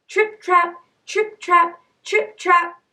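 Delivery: high, rhythmic trip-trap; hoofstep sound effect. A narrator's reading. Goat's hooves crossing the bridge.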